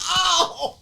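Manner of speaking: high-pitched "ow"; re-enacted pained yelp. Dad recreating his reaction to a snapped arm in the theater, pained yelp. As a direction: yelp like wincing at a gory scene. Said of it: "I went like that".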